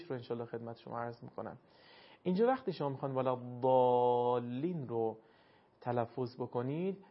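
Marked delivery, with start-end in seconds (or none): 3.1-4.9 slow «ولا الضالین», vowel after ض held long; elongated madd. Shown as a demonstration. Teacher tells students to stretch it, long aa in «الضالین». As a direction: stretch the aa of «الضالین» well past normal length. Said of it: "این رو بکشید".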